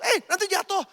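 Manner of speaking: high, sudden mock warning; acted alarmed warning. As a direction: call out like a worried passenger. Passenger warns a reckless rider.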